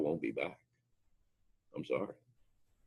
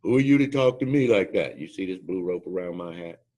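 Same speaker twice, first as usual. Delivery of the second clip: indignant outburst; 5 semitones higher, 10 dB louder than usual. Retold or imitated. Angry instructor confronts speaker.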